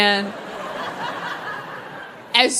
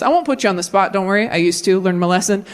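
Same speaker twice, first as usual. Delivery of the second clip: ordinary talk, nothing marked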